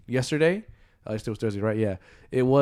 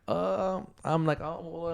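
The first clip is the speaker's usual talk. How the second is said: higher, halting stammer; retold awkward hesitation; 6 semitones higher, 4 dB quieter, slower than usual. Cashier answering a customer worried he is sick, awkward hesitation. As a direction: stall softly while finding an excuse.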